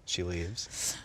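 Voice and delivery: soft deadpan stage direction. Acted. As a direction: read it like a script note. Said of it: "with you as every character".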